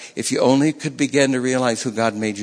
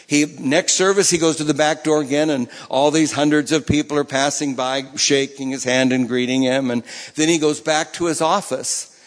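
ordinary talk, nothing marked

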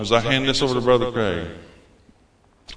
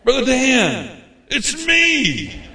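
much higher, slow, calling out mock voice; happy voice from heaven; 13 semitones higher, much slower than usual. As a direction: sing out like a long-lost friend. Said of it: "I hear somebody say".

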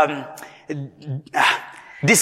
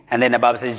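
usual speaking voice

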